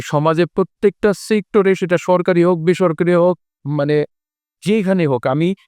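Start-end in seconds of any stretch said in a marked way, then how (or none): none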